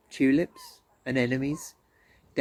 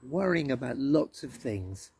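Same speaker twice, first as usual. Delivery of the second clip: ordinary delivery, nothing marked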